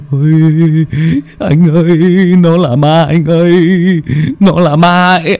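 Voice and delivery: high, shaky stammering whine; whimpering on the verge of tears. Acted. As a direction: plead with your brother, about to cry. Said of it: "mếu máo như sắp khóc".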